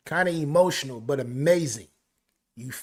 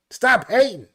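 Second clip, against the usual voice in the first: higher, louder hype shout of the lyric; loud repeat; 7 semitones higher, 6 dB louder than usual. Retold or imitated. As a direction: belt it out like the hook.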